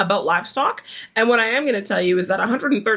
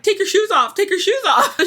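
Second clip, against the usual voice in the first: urgent; 9 semitones higher than usual. Retold or imitated. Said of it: "Daniel's like".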